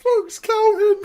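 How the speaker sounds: can't stop laughing while saying it. Real, not acted. Fan in stitches as Brock counts his suplexes.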